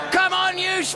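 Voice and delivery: high, excited cheer for Spurs. Real, not acted. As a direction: cheer the team on. Cheering his team at the match.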